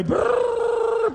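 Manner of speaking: long high trilled brrr; imitated whirring burst; imitated power surge. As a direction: roll out a sustained trill like being swept away. Imitates God's power seizing him.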